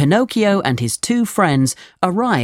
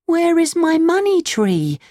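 put-on high puppet-boy voice; high, bewildered storybook Pinocchio; puzzled Pinocchio. A narrator's reading. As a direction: ask it way up high, searching. Pinocchio cannot find the tree.

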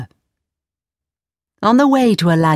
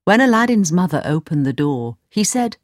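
ordinary delivery

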